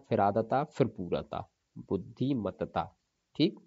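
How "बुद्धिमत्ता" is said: ordinary talk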